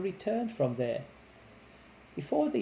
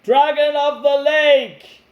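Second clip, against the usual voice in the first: prince yelling; 9 semitones higher, 13 dB louder than usual. Acted. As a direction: bellow it across the lake. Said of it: "yelled as loud as he could".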